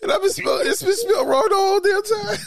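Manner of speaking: real laughter pushing the voice way up; helpless laughter. Real, not acted. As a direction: confess through a laughing fit. Side hurting from laughing, keeps confessing.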